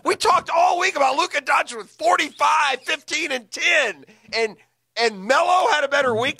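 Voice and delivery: incredulous rant. Real, not acted. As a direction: build up the outrage. Outraged rant about NBA award.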